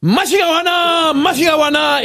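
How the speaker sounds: cri aigu et lent; crie-le comme au lit, très aigu; cri de la Japonaise. Acted. Français crie l'encouragement japonais. (la Japonaise crie pendant les ébats)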